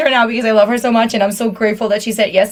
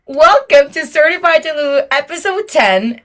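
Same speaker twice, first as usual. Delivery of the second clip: podcast intro; 5 semitones higher, 3 dB louder than usual. Real, not acted. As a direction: announce the show title like a host. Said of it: "This is gonna be the intro".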